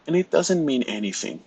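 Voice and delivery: ordinary talk, nothing marked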